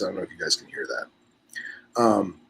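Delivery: usual speaking voice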